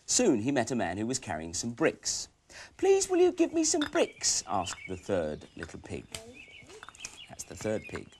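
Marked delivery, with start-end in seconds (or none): 2.8-4.4 high pig voice. Acted. Pig voice asking the man.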